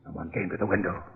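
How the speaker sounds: low urgent whisper; hushed whisper. Acted. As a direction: keep it barely audible, hiding. Hiding by the window at night.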